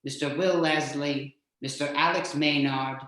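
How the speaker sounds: usual speaking voice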